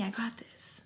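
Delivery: hushed, voiceless aside; joking whisper. Retold or imitated. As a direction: whisper it as a mock-confident aside. Joking aside about taking the phone.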